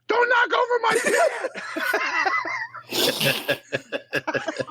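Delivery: very high imitation of an upset woman; mock shrieking woman. Friend retells her scolding over a kicked potted plant, high mock shriek. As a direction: squawk it out far above your own voice. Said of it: "she don't sound like [name] makes her sound".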